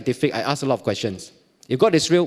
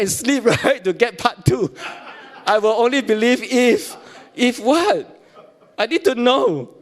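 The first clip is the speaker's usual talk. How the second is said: laughing; 7 semitones higher, slower than usual. Retold or imitated. Laughing retelling of dream.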